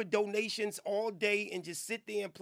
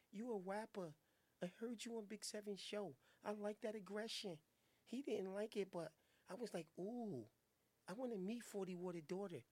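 soft-voiced swooning fan; 11 dB quieter than usual. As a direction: coo it like a smitten groupie.

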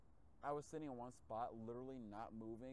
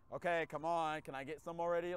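raised, impatient pleading; 4 semitones higher, 8 dB louder than usual. Retold or imitated. Impatient driver begging the app for orders, raised pleading. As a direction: hurry the app up, louder. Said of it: "So I'm impatient".

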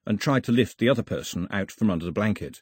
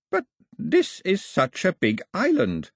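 disbelieving; 8 semitones higher, slower than usual. Acted. Priest disbelieving.

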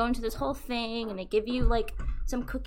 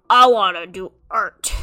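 mock declaration; 3 semitones lower, 11 dB louder than usual. Lawyer quits to do art.

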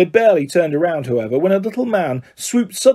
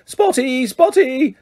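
high-pitched taunting call; yelling; 7 semitones higher, slower than usual. A narrator's reading. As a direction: yell the nickname high, without full volume.

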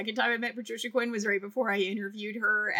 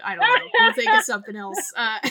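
laughing; 12 semitones higher, 8 dB louder than usual. Real, not acted.

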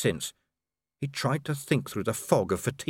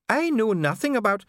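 frightened denial; 9 semitones higher, 4 dB louder than usual. Acted. Prisoner dwarf under interrogation.

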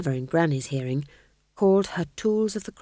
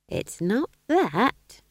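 put-on very slow, dry old witch; Nanny's voice; 4 semitones higher, much slower than usual. A narrator's reading. Nanny Og dismissing the king's guess, slow and dry. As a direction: drag it out, unimpressed. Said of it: "said Nanny".

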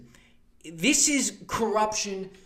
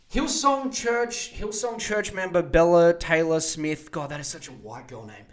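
ordinary talk, nothing marked

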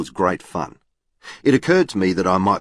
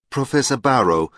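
put-on lightly Norwegian-accented address; 7 semitones higher than usual. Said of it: "She spoke with a Norwegian accent".

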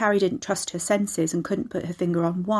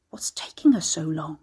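hushed, whispered grumble; impatient whisper; 2 semitones lower than usual. A narrator's reading. Unseen voice grumbles, audibly whispered.

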